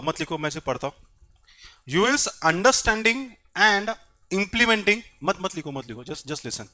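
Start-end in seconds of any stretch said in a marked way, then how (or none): 1.9-5.0 slow, loud dictation, word by word. Real, not acted. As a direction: dictate slowly and firmly for note-takers. Dictating the acronym to the class. (धीरे-धीरे, ज़ोर से लिखवाते हुए)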